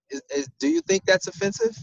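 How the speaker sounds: ordinary delivery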